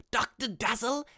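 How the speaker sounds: high, loud silly character name; hammed-up showy name; Doctor Dazzle voice. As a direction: belt the name in a put-on swooping voice. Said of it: "doing that voice".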